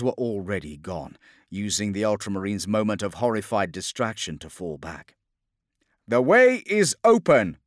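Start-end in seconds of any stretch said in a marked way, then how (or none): 6.1-7.6 high, loud battlefield call; shouted officer's report; lieutenant over vox. Acted. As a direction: announce it to the troops by radio. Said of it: "voxed Lieutenant Cassian".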